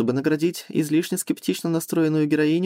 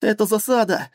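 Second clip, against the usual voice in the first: panicked under fire; 5 semitones higher than usual. Acted. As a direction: warn everyone in panic. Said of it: "заорал в ответ пилот".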